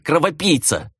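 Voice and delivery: high-pitched insult hurled in anger; accusing, falling at the end; furious growl. A narrator's reading. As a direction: fling the name as a furious insult. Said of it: "яростно прорычала Хранья".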